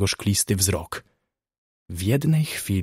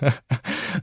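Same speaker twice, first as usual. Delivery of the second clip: short dry chuckle in character; brief, contained laugh at an absurd case; amused chuckle; 2 semitones higher, 4 dB quieter than usual. Acted. Surgeon amused by the visions.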